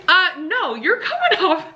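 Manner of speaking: amused disbelief; half laughing comeback; laughing retort. Retold or imitated. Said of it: "I'm like".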